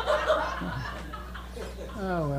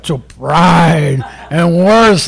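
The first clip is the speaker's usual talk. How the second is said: very slow recitation; 3 semitones higher, much slower than usual. Acted. Repeats Milton line.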